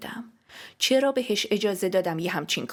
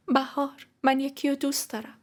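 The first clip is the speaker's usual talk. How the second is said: higher, level, hesitant confession; tense, pitched-up admission; forced-out confession; 6 semitones higher than usual. Acted. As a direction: get the words out with effort. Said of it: "لبای خشکیدم و به زور باز کردم".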